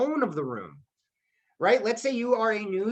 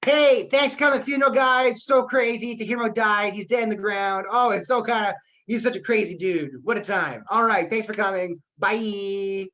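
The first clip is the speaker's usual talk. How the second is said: a careless mourner thanking funeral guests, way too chipper; inappropriately upbeat eulogy; 3 semitones higher, 3 dB louder than usual. Shown as a demonstration. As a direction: toss off the funeral like it's nothing. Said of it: "here's how I'm not gonna give his eulogy".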